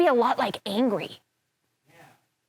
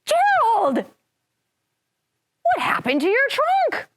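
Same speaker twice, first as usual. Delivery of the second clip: high, loud, then slow alarmed call; Piggy calling out; 12 semitones higher, 4 dB louder, much slower than usual. Acted. Piggy shouts to Gerald about his trunk. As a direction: call out to a friend in shock.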